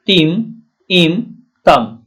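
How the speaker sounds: slow split syllables तिम, इम; teacher breaking अंतिम into its ending, slowly; syllable drill. Shown as a demonstration. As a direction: drag out तिम and इम one by one. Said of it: "अंतिम में देखिए, इम आ रहा है".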